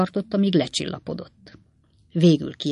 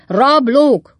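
loud, high, falling yell; full-voiced outraged roar; 8 semitones higher, 10 dB louder than usual. A narrator's reading. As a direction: bellow the accusation at higher pitch. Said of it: "ordította Pickwick úr".

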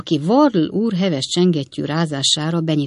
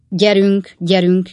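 loud, insistent hurry-up call; brisk raised-voice command; shouted urging; 3 semitones higher, 7 dB louder, slower than usual. A narrator's reading. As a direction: call out to get them moving. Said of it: "kiáltotta Wardle úr".

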